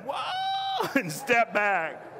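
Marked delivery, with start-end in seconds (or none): 0.1-0.9 very high mock-alarmed whoa. Whole line stepping back from the fight, high alarm. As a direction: squeal it as you back away. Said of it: "we all went".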